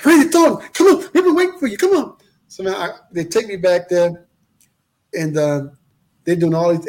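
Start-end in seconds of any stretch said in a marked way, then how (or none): 0.0-2.2 high, loud, eager welcome in an imitated voice; gushing receptionist. Dentist's receptionist welcomes the expected patient, gushing.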